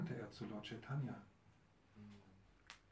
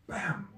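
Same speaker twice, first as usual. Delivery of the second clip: loud bam; 8 semitones higher, 11 dB louder than usual. Acted. Storyteller mimics the jug striking.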